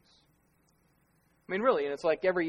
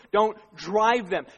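building louder, slowing on each phrase; preaching; 6 semitones higher, 11 dB louder, slower than usual. Acted. Pastor warning parents against lecturing, rising preaching. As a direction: preach it harder line by line.

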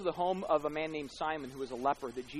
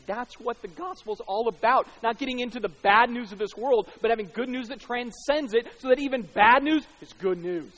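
high, loud, driving sermon delivery; emphatic pulpit climax; impassioned preaching; 8 semitones higher, 13 dB louder than usual. Real, not acted. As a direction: proclaim the gospel point with full force. Preaches at full force.

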